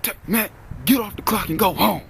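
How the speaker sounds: high-pitched mock yell of a manager; high retold yelp; imitated yelling. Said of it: "He yelp, and he know I don't fucking like yelling".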